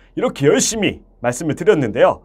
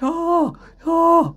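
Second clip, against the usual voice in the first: high, hollow call kept inside; held-back singer's hail; sound pulled backward; 12 semitones higher, much slower than usual. Shown as a demonstration. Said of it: "소리를 내가 갖고 있거나 이 뒤쪽으로".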